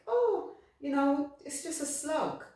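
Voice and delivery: a high, scoffing brush-off; dismissive mock. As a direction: toss it away like it's nothing, mock voice. Mocking people who dismiss slugs.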